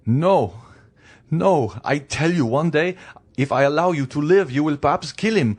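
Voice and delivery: loud, high-pitched accusing refusal; adamant husband. Acted. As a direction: override the plea with forceful conviction.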